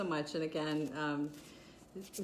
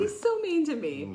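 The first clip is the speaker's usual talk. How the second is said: mock whine; 10 semitones higher, 5 dB louder than usual. Mock complaint about being teased.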